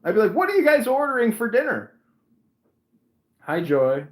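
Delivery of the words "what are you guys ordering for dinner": high, loud attempt at small talk; strained fake composure. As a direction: shout over the noise, pitched right up. Drowning out the imagined demons at dinner.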